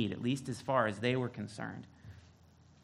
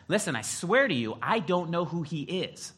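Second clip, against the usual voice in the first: higher, firm retold insistence; firmer denial; 6 semitones higher, 5 dB louder than usual. Peter denying Jesus to the second girl, in a higher, firmer voice. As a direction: swear it firmly, acting out Peter. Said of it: "Peter gets a little firmer".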